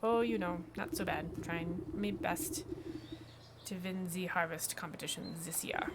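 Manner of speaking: put-on farmer character with a German accent. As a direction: play the farmer as a German speaker. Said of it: "I have no idea why it's a German accent".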